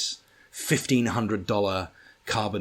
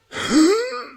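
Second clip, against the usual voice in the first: put-on child's strain grunt; 6 dB louder than usual. Hears the toddler behind him.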